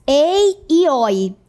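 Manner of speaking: "ei e oi" slowly, each with an open vowel; open diphthongs. Shown as a demonstration. Teacher naming the diphthongs that lost accents, slow open vowels. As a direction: hold "ei" and "oi" wide open, drawn out. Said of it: "Os ditongos abertos".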